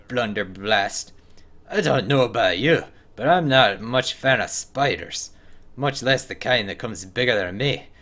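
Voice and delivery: hammed-up Scottish accent, lively pitch swings; horrible Scottish accent. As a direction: read it out as a bad Scot with a Scottish accent. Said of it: "my horrible Scottish accent".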